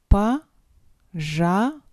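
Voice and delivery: ordinary talk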